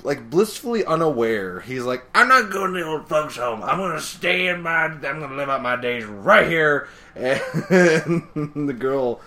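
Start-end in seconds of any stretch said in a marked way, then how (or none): 2.1-6.9 put-on loud, cranky old-codger voice; comic defiant old fellow; stubborn old man. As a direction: dig in your heels as an old-timer.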